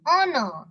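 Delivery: "honor" as on-or; silent H. Shown as a demonstration. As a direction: say "honor" starting on the vowel. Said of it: "Honor, not honor".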